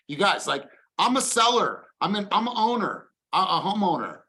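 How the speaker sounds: high, loud, disbelieving homeowner; incredulous seller. Retold or imitated. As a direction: play the shocked seller who can't believe it. Acting out a surprised home seller.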